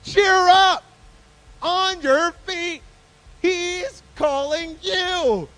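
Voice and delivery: high, loud, long-drawn holler; big rousing shout, very slow; calling out. A narrator's reading. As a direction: holler it across a crowd, stretching each phrase. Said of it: "So they called to the blind man".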